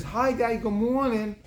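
put-on high little-girl greeting; cheerful daughter. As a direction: greet daddy like a small child. Daughter greets dad on phone.

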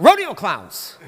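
sudden loud mock outburst; imitated shout. Preacher imitates brother blurting out his pitch, shouted. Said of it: "and he shouts out".

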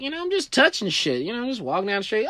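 high, breezy, carefree swagger; carefree strut voice. Retold or imitated. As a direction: act out strolling along without a care. Casual strut, showing carefree self.

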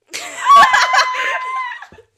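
very loud, high-pitched burst of laughter; shrieking laugh. Real, not acted. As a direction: explode into a high cackle. Friend bursts out at own joke.

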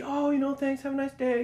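high, bright customer-service voice; professional cashier voice. Retold or imitated. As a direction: chirp it like a polite clerk. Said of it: "being real professional".

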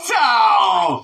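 loud, high, drawn-out cry; shouted reaction. Real, not acted. Crying out at a joke.

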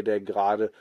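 ordinary delivery

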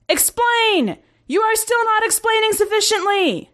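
very high, shouting commander voice; exasperated commander. A narrator's reading.

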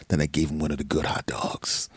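soft, hushed narration; whispered aside. Real, not acted. Telling the story, dropping to a whisper. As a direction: drop to a whisper.

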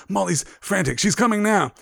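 urgent news while catching breath; panting. Acted. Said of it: "Mister Weasley panted".

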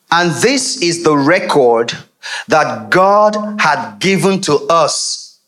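slow, deliberate reading of the verse; scripture reading. Real, not acted. Preacher reading the verse to the congregation, slow. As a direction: read each word out slowly.

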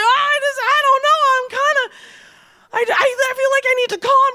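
high, fast, rattled voice; a frightened boyfriend trying to calm himself down; shaken rambling. Retold or imitated. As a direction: babble as if shaken.

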